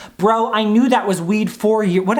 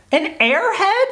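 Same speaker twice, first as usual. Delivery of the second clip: high squeaked question; very high, rising squeak; squeaky voice; 11 semitones higher, slower than usual. Shown as a demonstration. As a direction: repeat it in disbelief, voice shooting up. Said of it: "There it is".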